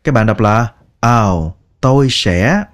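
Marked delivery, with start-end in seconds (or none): none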